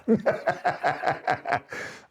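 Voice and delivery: short airy chuckle at his own brag; breathy laugh. Real, not acted.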